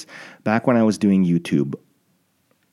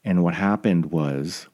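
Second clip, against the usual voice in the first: normal talking voice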